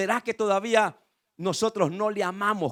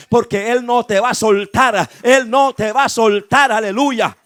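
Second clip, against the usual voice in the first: loud, driving sermon cadence; full-force preaching; 3 semitones higher, 10 dB louder than usual. Real, not acted. Preacher reminding believers God's voice rescued them, full-force preaching. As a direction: preach relentlessly, building to forceful shouts of assurance.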